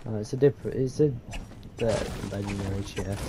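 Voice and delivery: disappointed. Retold or imitated. Mocks friend's disappointed tone.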